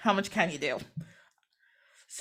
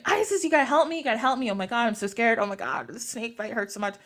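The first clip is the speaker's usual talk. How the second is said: ordinary delivery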